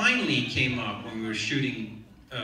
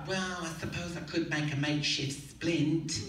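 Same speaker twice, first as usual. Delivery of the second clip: slightly put-on, higher nurse voice; set nurse; 5 semitones higher than usual. Nurse conceding to the actor, lightly voiced and higher. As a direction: lift the pitch lightly for a grudging offer. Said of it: "And she said".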